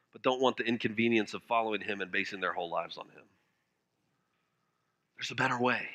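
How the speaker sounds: ordinary talk, nothing marked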